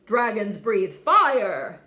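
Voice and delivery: loud, slow furious father; angry shout. Acted. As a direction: bellow the rule at him. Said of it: "shouted his father".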